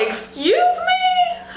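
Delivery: very high, drawn-out squeal of amazement; giddy disbelief. Real, not acted. Reviewer thrilled by the long wig, squealing disbelief. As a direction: stretch it up into a squeak. Amazed at forty-four inches.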